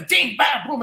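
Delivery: "ding, bam, boom" as three sharp beats; punchy rhythm. Shown as a demonstration. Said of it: "you know, really".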